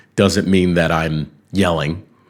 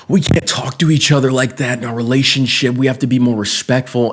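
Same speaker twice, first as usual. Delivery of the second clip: put-on girlfriend scolding; 6 semitones higher than usual. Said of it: "And she's like".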